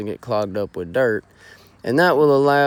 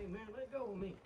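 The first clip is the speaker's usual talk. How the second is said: strained high protest; 9 semitones higher, 24 dB quieter, faster than usual. Acted. Scuffle in the blind.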